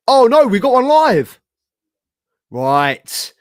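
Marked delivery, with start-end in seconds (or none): 0.1-1.4 bursts out much louder and higher; suddenly thrilled to catch it live; excited surprise. Real, not acted. Streamer spotting the live interview, loud and surprised.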